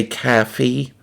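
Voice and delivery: "caffey" with an open, unrounded first vowel; non-East Coast pronunciation. Retold or imitated. Streamer asking viewers if they say it so, non-East Coast "caffey". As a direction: say "caffey" for coffee, as "CAH-fee". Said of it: "Do you say caffey?".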